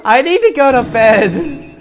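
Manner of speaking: high, loud, drawn-out laughing wail; overwhelmed, loud and laughing; laughing despair. Real, not acted. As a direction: wail it slowly through laughter. Overwhelmed by the game.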